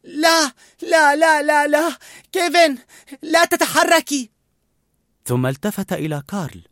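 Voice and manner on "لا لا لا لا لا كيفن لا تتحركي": high, loud repeated cry of no; boy begs in panic. A narrator's reading. Boy cries to hurt bird not to move. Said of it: "صرخ راسل".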